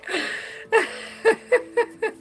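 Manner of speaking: high, airy giggling fit; helpless amused laugh. Real, not acted. Reader urging client to go out, helpless laugh. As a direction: crack up at your own advice. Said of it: "you're cracking me up".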